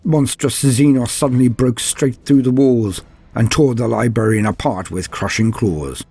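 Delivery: ordinary delivery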